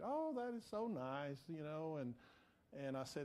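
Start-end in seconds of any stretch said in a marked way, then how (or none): 0.0-1.4 higher-pitched, softer, touched reply; imitated sweet woman. Lady thanking a stranger for offering prayer, sweet. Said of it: "she was so sweet".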